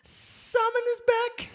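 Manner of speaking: high, slow falsetto announcement; announcer voice. Pretend. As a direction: herald it in a high sing-song. Announcing the troll's return.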